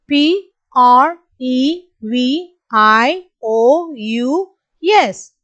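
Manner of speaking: each letter slow and spaced; slow spelling. Shown as a demonstration. Teacher spelling slowly for the class. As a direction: spell slowly so kids can follow. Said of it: "repeat along with me".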